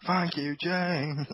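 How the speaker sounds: slow mock wrestling announcer thanks; a hint of a ring announcer, drawn out; lightly voiced wrestling-style thank you. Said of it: "Like in the wrestling".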